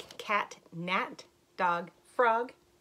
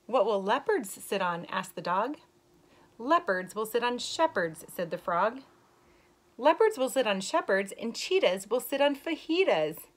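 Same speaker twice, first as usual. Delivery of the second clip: story reading; 3 semitones higher, 6 dB louder than usual. Acted. Teacher reading a rhyming book to young kids, lilting. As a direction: read the rhyme brightly to the class. Reads picture book to children.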